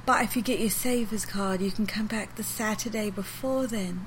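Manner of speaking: lightly voiced old lady, slow and gentle; slow sweet old English lady. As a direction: drag each word out like a sweet little old shop lady. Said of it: "she's moved super slow".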